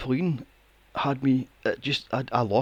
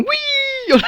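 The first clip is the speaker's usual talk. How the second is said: very high, loud squeal of delight; kids squealing whee; 5 dB louder than usual. Retold or imitated. Imitating kids on a trampoline with a high squeal. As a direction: shriek it like a kid on a trampoline. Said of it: "They're going".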